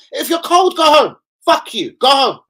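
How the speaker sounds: furious go home; angry yell. Real, not acted. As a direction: shout them out of the stadium. Yelling at cold fans.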